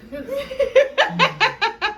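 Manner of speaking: drawn-out laughing plea; very slow, high, laughing. Real, not acted.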